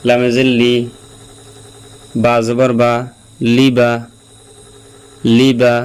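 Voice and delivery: ordinary talk, nothing marked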